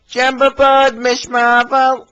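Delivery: high, loud welcome, stretched out slow; high, slow, cheerful invitation; cheerful Billy. Acted. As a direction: call her aboard, bright and slow. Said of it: "he whistled".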